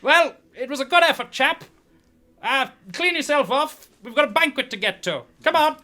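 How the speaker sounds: high, loud, brisk put-on voice; rally the loser and hurry him along; brisk consoling prince. Prince to his beaten champion, brisk and consoling.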